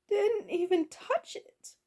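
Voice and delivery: slow, high-pitched distraught wail; crying goblin cook. A narrator's reading. Goblin cook laments her failed feast, crying. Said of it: "cried Orla".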